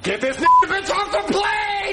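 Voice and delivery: raging yell; angry shouting. Acted. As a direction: bellow it in fury. Red pencil rage tantrum.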